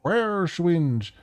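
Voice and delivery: high-pitched, excited man with urgency in his voice; jittery, urgent. Acted. Man tells Hermie they have found her, jittery and urgent. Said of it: "His speech is jittery. You can hear the urgency in his voice".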